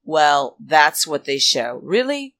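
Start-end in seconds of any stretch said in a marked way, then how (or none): 1.8-2.4 high, incredulous really; scoffing at them; sarcastic disbelief. Real, not acted. Mocking the production team.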